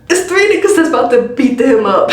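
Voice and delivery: half-shouted through real laughter; cracking up while blurting it out; laughing shout. Real, not acted. Friend telling viewers the odds, laughing hard.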